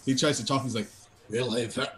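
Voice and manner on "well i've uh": a touch of hoarse rasp. Acted. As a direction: croak it out lightly. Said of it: "I'm a little hoarse".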